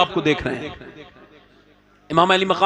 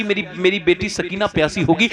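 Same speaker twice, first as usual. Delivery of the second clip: ordinary talk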